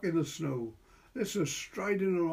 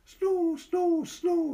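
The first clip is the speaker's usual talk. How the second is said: put-on high, loud child's chant; delighted child; 5 dB louder, much slower than usual. A narrator's reading. Boy chanting as he scoops snow. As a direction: chant it like a thrilled little boy.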